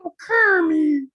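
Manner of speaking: high, slow swooning Piggy; Miss Piggy voice. Retold or imitated. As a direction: sigh his name like a diva pig. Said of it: "Do Miss Piggy again".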